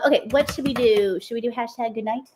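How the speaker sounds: ordinary talk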